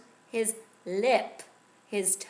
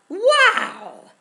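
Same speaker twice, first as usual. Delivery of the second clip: wow; 14 dB louder than usual. A narrator's reading. Snake appears.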